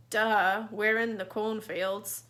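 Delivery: sarcastic duh. Acted. Mocking a friend's obvious remark.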